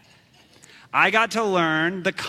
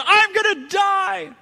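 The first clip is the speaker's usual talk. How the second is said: high, loud, slow panicked yell; 14 semitones higher, 5 dB louder, slower than usual. Retold or imitated. Bitten boy yelling to the beach in panic. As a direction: wail it like you're doomed. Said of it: "and I yelled".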